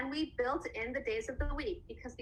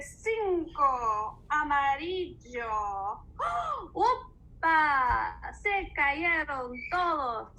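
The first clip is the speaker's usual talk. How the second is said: lively storybook rhyme in Spanish; bright read-aloud counting rhyme; reading to children; 5 semitones higher, 5 dB louder than usual. Acted. Teacher reading the rhyme again in Spanish, lively.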